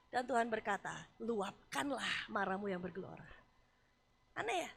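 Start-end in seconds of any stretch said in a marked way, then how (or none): 1.2-3.4 gentle invitation. Acted. God speaks gently.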